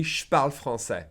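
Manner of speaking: "je parle" said as "shparle"; casual "je parle" with je shrunk to sh; blended sh. Shown as a demonstration. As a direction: run "je parle" together, starting on sh. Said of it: "blend the P and the J together into sh".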